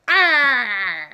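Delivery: loud, drawn-out mock attack yell; mock attack cry. Man lunging to bite the bully, mock attack cry. Acts dog owner attacking, biting.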